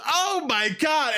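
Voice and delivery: shocked at what is in the bag; freaking out. Retold or imitated. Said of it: "freaking out".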